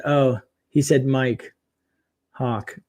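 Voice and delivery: ordinary talk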